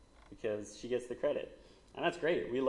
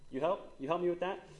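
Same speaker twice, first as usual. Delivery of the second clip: put-on small child asking; 7 semitones higher than usual. Mother quoting daughter's reply.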